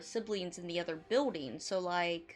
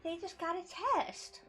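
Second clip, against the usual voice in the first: sarcastic; 9 semitones higher, slower than usual. Real, not acted. Mocking the villain's pseudoscience.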